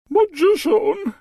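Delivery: very high, slow, drawn-out royal moan; moaning queen. Acted. Queen moaning to the magician at his door. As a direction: moan the name like a pained queen. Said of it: "she moaned".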